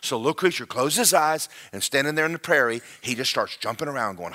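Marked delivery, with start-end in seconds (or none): none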